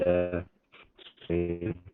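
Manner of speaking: choppy, breaking-up mic audio; mic cutting out. Real, not acted. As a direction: voice dropping out over a bad connection. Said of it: "Your mic's cutting out a little bit".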